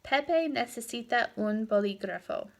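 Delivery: "Pepe necesita un bolígrafo" coming down at the end; statement intonation. Shown as a demonstration. Teacher quizzing students by intonation, "bolígrafo" falling as a statement. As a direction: let "bolígrafo" fall as a fact. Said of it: "That's a statement".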